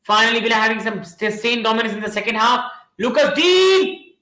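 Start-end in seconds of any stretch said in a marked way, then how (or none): 3.0-4.1 high, drawn-out shout of the name; rising commentator call; excited call. Real, not acted. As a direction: call the player's name as the attack builds. Live commentary, attacking moment.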